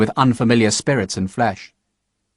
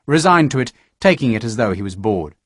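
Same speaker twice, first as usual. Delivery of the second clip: usual speaking voice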